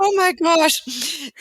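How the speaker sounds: slow, high gasp of shock; gasping surprise. Retold or imitated. Teacher meeting the first lady, slow high gasp. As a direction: clutch your pearls and gasp it out slowly. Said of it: "clutch the hu".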